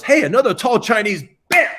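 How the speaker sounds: loud, high-pitched exclamation; sudden exclamation. Retold or imitated. Chef retells spotting his own reflection before impact, loud exclamation. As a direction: call out high and loud, cut off by a bam. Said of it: "The last thought is".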